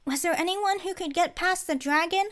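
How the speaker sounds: put-on high, eager kid's question; eager child voice. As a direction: ask it like a curious child.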